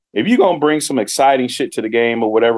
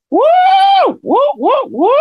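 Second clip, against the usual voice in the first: much higher shouted whoops, cartoon fan; mock crowd whoops; 4 dB louder than usual. Mocking the paid audience at every event, high shouted whoops. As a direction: yell woo like a hyped audience. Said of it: "they did the same shit".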